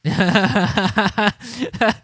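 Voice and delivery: loud burst of real laughter; big laugh. Real, not acted. Streamer bursting out laughing at the replies. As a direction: crack up out loud.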